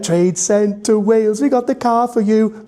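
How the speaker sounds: advert slogan. Shown as a demonstration.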